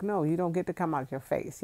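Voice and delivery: ordinary talk, nothing marked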